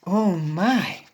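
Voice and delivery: ordinary delivery, nothing marked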